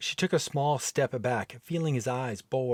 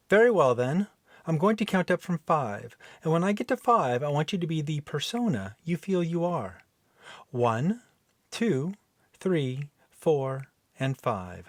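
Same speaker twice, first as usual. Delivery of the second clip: higher, brighter count up to wake him; brisk hypnotist voice; 4 semitones higher than usual. Acted. Rising hypnotist count. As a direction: Helen bringing Dan out of the trance into a persona, rising count.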